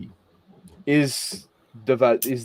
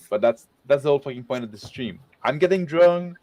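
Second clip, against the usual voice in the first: ordinary talk, nothing marked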